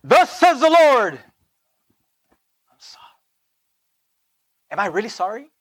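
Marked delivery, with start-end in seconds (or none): 0.0-1.1 loud, slow prophetic pronouncement; harsh prophet. Shown as a demonstration. Preacher shows the wrong tone, proclaiming loudly. 2.7-3.2 whispered apology; soft whisper. Shown as a demonstration. Preacher shows the right tone, whispering sorry. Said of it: "Do you hear my tone?".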